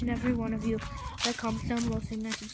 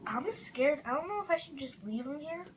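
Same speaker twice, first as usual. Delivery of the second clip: ordinary delivery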